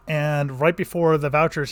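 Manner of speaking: ordinary delivery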